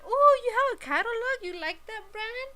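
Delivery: high, gushing fake enthusiasm; butter someone up with put-on excitement; exaggerated friendly flattery; feigned interest. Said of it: "My mom was bullshitting".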